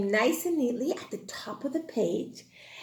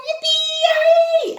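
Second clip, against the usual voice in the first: cheering; 7 dB louder, slower than usual. Acted. Teacher cheering for class.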